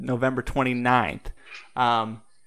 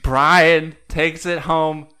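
slow, drawn-out announcer build; game show host; 2 semitones higher, 4 dB louder, slower than usual. Acted. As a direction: announce the winner grandly, slow.

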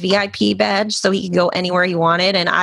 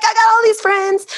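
high, giddy boast; excited; 3 dB louder than usual. Retold or imitated. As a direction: brag like a thrilled kid. Quoting excited stepdad.

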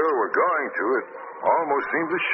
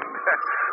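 mocking laugh. Villain mocking.

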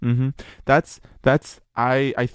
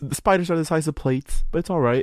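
higher-pitched mock Australian nonchalance; nonchalant local; 7 semitones higher than usual. Imagined Australian shrugging off giant spiders, mock nonchalant. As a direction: play an unfazed local, pitched up.

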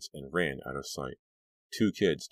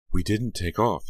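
louder, higher sharp retort; snap; 5 semitones higher, 7 dB louder than usual. Acted. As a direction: snap back defensively. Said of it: "Dee snapped".

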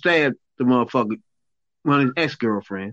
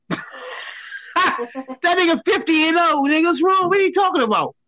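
high, laughing, drawn out; laughing disbelief; 14 semitones higher, slower than usual. Real, not acted. Host laughing at the diss on Mayweather, high disbelief.

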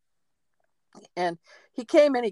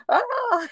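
high, short mock yelp of fright; imitating the terrified helper's high yelp; freaked-out yelp; 3 dB louder than usual. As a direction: squeak it like a scared friend. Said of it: "was freaking out".

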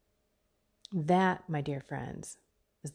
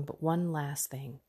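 ordinary talk, nothing marked

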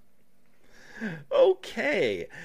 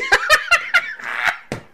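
helpless laugh; 4 dB louder than usual. Real, not acted. Cracking up after quote.

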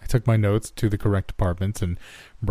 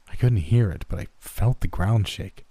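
ordinary delivery

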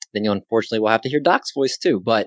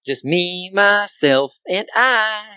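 higher, sing-song self-introduction; 7 semitones higher, much slower than usual. Pretend. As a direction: lift it into a playful sing-song. Sing-song self-introduction, then echoed softly.

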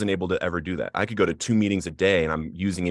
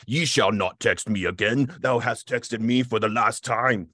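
King James English; 3 semitones higher than usual. Retold or imitated. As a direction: read it like a stern old scripture verse. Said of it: "it was in, like, King James".

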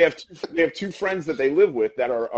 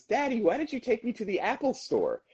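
put-on high, small kid voice; little girl; 10 semitones higher, 4 dB quieter than usual. Retelling the daughter asking her father in a high little girl voice. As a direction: ask it like a puzzled little girl. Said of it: "the little girl looks up at her father and says".

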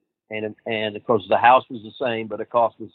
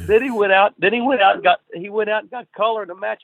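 amused; 10 semitones higher, 5 dB louder than usual. Real, not acted. Excited telling, laughing.

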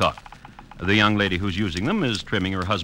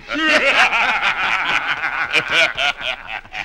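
a big tavern belly laugh; hearty pirate laugh; 5 semitones higher than usual. Acted.

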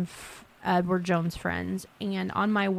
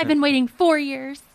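dry, mocking sarcasm; deadpan it with a sneer; sarcastic; 7 semitones higher, 7 dB louder than usual. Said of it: "I'm going to so be sarcastic".

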